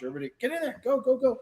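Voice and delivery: high, eager call to gather everyone; rally the group into the shot; herding friends in for a group photo, eager call. Real, not acted. Calling group into photo huddle.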